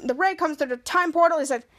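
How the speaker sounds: usual speaking voice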